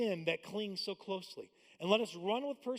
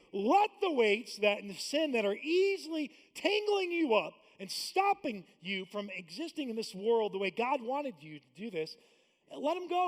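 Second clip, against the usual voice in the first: loud, urgent quoting of scripture; preached quote; 4 semitones higher, 7 dB louder than usual. Retold or imitated. Pastor urging the church to drop their weights, loud. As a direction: press the verse on the listeners. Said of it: "the author of Hebrews is saying".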